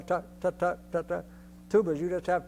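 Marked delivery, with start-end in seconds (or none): 0.0-1.2 steady unaccented TA rhythm; no accents. Shown as a demonstration. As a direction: chant every TA evenly, none stressed. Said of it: "no accents at all".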